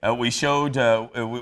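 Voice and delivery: ordinary talk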